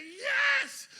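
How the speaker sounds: high, loud yes; eager crowd yes. Retold or imitated. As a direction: shout it like a convinced fan. Crowd answering the tightrope walker.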